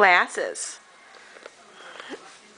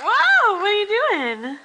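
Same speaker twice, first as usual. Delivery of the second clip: startled, pitched way up; surprised high whoa; 9 semitones higher than usual. Real, not acted. Mother startled as toddler climbs on her, high whoa. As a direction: gasp of delight, rising high.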